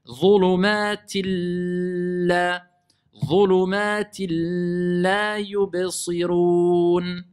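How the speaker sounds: slow sustained chant, long L in Dhulumatil la; measured tajwid, very long vowels; merged recitation. Shown as a demonstration. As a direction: recite steadily, holding the L and the final yubsirun. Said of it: "je vais dire illa".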